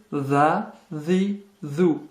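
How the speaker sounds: "za, zi, zou" with voiced TH as in English "this". Shown as a demonstration. Teacher sounding out the letter dhal, English TH. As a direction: put the tongue between the teeth for "za, zi, zou". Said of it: "C'est le T H en anglais".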